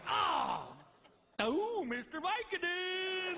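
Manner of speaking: high, slow mock yell of pain; pained cry. Son lands on his hernia, slow high mock cry. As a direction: cry out for the pills. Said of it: "I'm just like".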